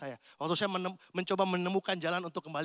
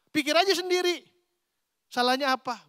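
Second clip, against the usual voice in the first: higher, louder huffy snap; huffy wife retort; 9 semitones higher, 8 dB louder than usual. Retold or imitated. Wife snapping at her clueless husband, huffy and loud. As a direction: play the wife tossing it back at her husband. Wife's sulky retort repeated.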